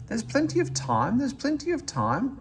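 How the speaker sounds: high, lightly voiced grumbling coaches. A narrator's reading. Coaches grumbling at Thomas, voiced high and mock-grumpy. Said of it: "grumbled the coaches".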